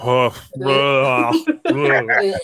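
demonstrated heaving vomit noises; mock retching. Shown as a demonstration. Son showing the game he plays with his parents, mock retching. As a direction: act out a disgusted gag. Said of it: "I make heaving vomit noises".

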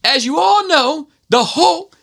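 very high imitation of a yelling instructor; drill instructor's accent. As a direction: lecture the squad in his high, accented bark. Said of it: "and he's like".

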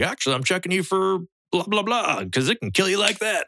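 doctor's reply pitched high; doctor voiced. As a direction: play the doctor explaining, voice up high.

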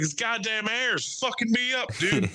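high-pitched comic griping; hammed-up complaining; mock complaint. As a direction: whine it in a put-on high voice. Acted complaint in retold scene.

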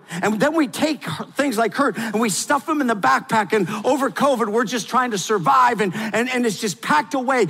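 high, loud, driving sermon voice; full-force preaching. Acted. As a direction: preach it hard to the crowd.

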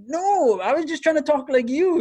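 high-pitched, laughing retold protest; flustered self-defense. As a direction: plead innocence through laughter, voice way up. Acting out his flustered defense.

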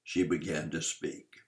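plain reading voice

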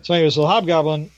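ordinary talk

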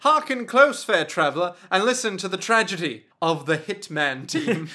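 loud, hammed-up olde storyteller; mock bard storyteller. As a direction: proclaim it like a medieval bard.

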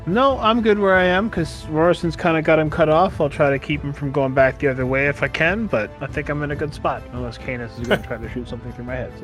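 ordinary talk

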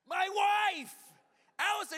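high, loud punchline; exclaimed reveal. Real, not acted. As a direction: shout out the answer in comic amazement. Delivers the punchline about wife.

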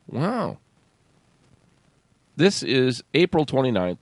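ordinary talk

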